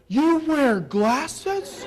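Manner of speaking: slow, high, dopey question; mock dumb question. Stranger asking about his glasses, slow dopey mock voice.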